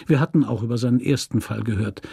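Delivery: ordinary delivery, nothing marked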